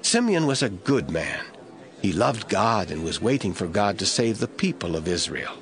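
ordinary talk

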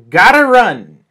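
loud, high "gotta run" falling at the end; big punchy exclamation; emphatic announcement. Shown as a demonstration. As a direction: call it out boldly as a headline. Announcing the new expression.

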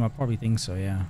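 usual speaking voice

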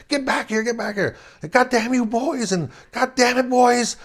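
put-on high, harsh shouts calling the boys back; retold angry yelling. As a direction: yell at the kids to get back here. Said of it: "yelling, screaming".

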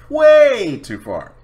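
stretched out exasperated outburst. Real, not acted. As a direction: drag out way too far in exasperation. Exasperated exaggeration.

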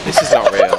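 high breathless disbelief. Real, not acted. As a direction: gasp it out, stunned. Disbelief watching the fight.

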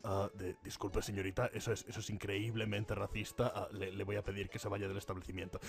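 very low, hushed official voice; offended clerk. Pretend. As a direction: softly ask her to leave, haltingly.

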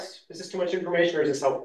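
fast, hurried, words tumbling out; pressured rushing speech. Real, not acted. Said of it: "'Cause I'm talking a mile a minute".

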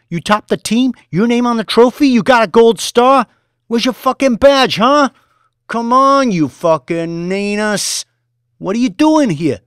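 high, loud sneering tirade; mocking yell. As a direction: humiliate him at full volume. Boss mocking trader.

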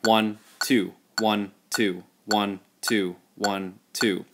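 "one, two" evenly on the dotted quarter; steady slow beat count. Shown as a demonstration. Teacher shows students the relaxed two-beat count, steady. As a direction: count only the two big beats. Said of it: "It's just gonna be".

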